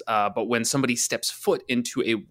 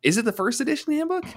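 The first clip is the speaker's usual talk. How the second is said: high questioning; 14 semitones higher, faster than usual. Real, not acted. Asking listeners, high-pitched.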